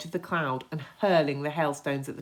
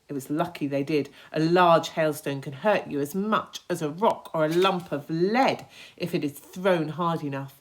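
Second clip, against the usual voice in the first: ordinary delivery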